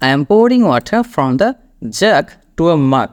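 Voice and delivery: reading the screen line slowly. Shown as a demonstration. Teacher reading a screen sentence slowly to students. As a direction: read the example word by word. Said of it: "যে লাইনটা আপনি দেখতে পাচ্ছেন".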